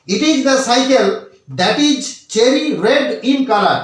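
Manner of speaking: very slow, word by word English reading; slow dictation. Shown as a demonstration. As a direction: spell the sentence out slowly for students to copy. Reads example sentence slowly. (ধীরে ধীরে শব্দ ধরে পড়া)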